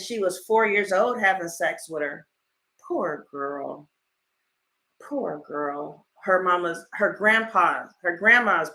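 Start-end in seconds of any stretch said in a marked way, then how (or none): 2.9-3.9 soft pity. Real, not acted. Pity for victim. 5.0-6.1 soft pity. Real, not acted. Pity repeated.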